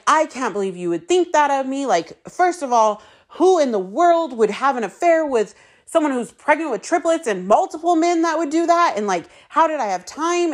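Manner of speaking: indignant pleading. Retold or imitated. Pleading with the accuser over the phone, high indignant protest. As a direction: protest your innocence, voice climbing high. Defending herself against accusation.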